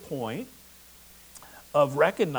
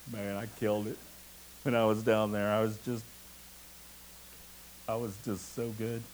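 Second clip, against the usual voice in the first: mock boasting; 5 semitones lower, 4 dB quieter, faster than usual. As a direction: play someone quietly bragging about themselves. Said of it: "boasting about how they got there".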